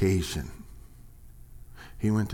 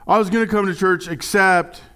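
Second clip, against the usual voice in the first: higher and louder, a whiny stammering excuse; mock excuse; 6 semitones higher, 5 dB louder than usual. Pastor mimicking excuses he's heard, whiny and halting. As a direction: fumble for an excuse, mockingly.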